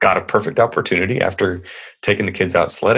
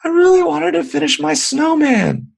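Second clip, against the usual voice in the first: put-on whiny little girl; 3 dB louder than usual. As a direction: whine it like a disappointed small child. Daughter voiced, whining.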